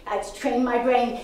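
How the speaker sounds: ordinary talk, nothing marked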